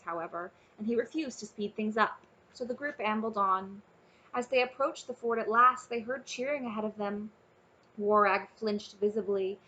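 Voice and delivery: ordinary delivery, nothing marked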